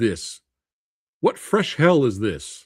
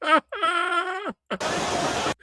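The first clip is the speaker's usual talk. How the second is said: high shrieking burst of laughter; 5 dB quieter than usual. Real, not acted. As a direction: scream with laughter. Watching the splash replay.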